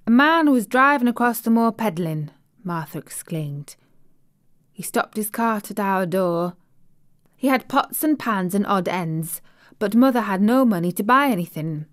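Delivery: lightly voiced Yorkshire housemaid, chatty and pleased; broad Yorkshire vowels, friendly and eager; Yorkshire maid. Maid telling the little girl about home, light Yorkshire accent. Martha's Yorkshire dialect speech.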